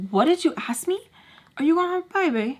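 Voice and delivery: high, rising shocked question; shocked disbelief. Retold or imitated. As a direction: ask it stunned.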